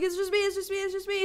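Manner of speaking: calm her down fast, voice up high; high, hurried reassurance. Retold or imitated. To the scared neighbor, a high hurried reassurance.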